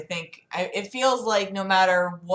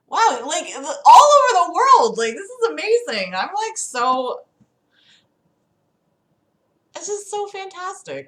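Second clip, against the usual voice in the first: much higher, excited rising voice; thrilled; 9 semitones higher, 6 dB louder than usual. Real, not acted. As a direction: gush about the audience. Excited at worldwide viewers.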